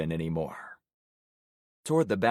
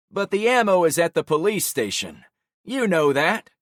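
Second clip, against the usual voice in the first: Javon's voice; 9 semitones higher, 7 dB louder than usual. Acted. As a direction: catch him out in the lie.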